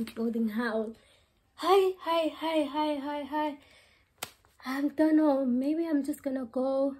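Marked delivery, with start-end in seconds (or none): 1.5-3.6 playful greeting. Real, not acted.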